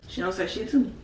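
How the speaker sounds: usual speaking voice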